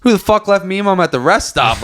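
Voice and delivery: high, startled outburst; stoned grandson suddenly panicking; mock alarm. Stoned grandson retelling his own startled reaction on the phone, high pitched. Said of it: "I'm like".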